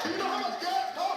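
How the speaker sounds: mock shout. Mocking eager reply to ride.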